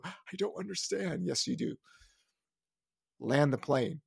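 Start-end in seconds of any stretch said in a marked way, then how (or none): 0.0-1.3 soft, feeble mock bewilderment; helpless official. Mocking Fed officials after rates rise, soft helpless bewilderment. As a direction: whimper it quietly, mocking their confusion. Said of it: "and then they're gonna go".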